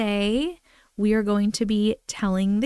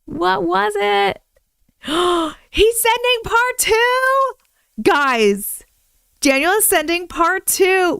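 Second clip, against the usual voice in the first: much higher and louder, slow and thrilled; excited squeal; 9 semitones higher, 6 dB louder, slower than usual. Real, not acted. Learning a viewer is sending part two, excited high squeal. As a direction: squeal with delight at the news. Excited about story news.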